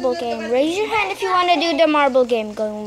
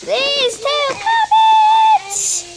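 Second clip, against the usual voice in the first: high, slow, thrilled yell; excited shout; 11 semitones higher, 8 dB louder, much slower than usual. Pretend. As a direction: shout the reveal with delight. Excited reveal of a trade offer.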